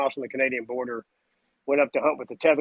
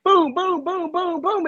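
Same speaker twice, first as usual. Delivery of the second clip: gunshot noises; 4 dB louder, slower than usual. Retold or imitated. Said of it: "He goes".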